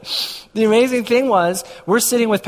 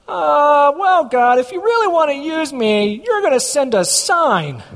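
higher-pitched, drawn-out mock bargaining prayer; mock hesitant Gideon dance; 7 semitones higher, slower than usual. Bargaining with God for a sign, mock hesitant. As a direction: haggle with God in a raised, put-on voice. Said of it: "we do the Gideon dance".

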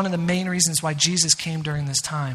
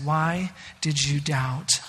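gentle rebuke; 6 semitones lower, 6 dB quieter, much slower than usual. Acted.

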